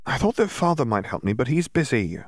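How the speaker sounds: put-on high little girl's voice; a child explaining simply; young Columba. A narrator's reading.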